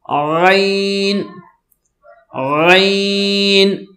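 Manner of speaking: غین with a throaty gh, not گھین, long drawn out; correct Arabic ghain. Shown as a demonstration. Teacher correcting learners who say گھین, throaty drawn-out غین. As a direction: hold غین very slowly with a throaty first sound. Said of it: "گھین نہیں پڑھنا ہم نے".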